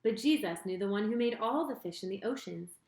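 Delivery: usual speaking voice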